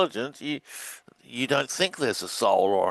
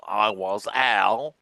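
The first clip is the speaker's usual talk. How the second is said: slow, drawn-out reading of the reversal; slow spoken reversal; 4 semitones lower, much slower than usual. Shown as a demonstration. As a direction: spell out the reversed phrase slowly. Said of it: "and backwards".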